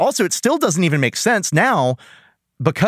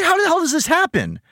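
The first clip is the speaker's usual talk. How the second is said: incredulous; 14 semitones higher than usual. Retold or imitated. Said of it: "I said".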